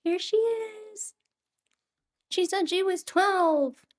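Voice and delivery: high, soft cooing. Real, not acted. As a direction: coo tenderly at the sleepy dog. Cooing at her dog.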